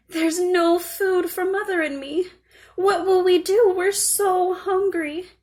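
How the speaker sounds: put-on high, teary child's voice near crying; a hungry little girl about to cry; tearful little girl. A narrator's reading. Little girl crying to herself in the forest, high and teary. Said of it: "started to cry".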